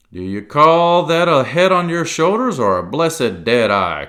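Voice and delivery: loud, high scornful outburst; angry cry. A narrator's reading. Silver scolding Morgan, loud and scornful. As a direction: blast him with scorn. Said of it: "cried Long John".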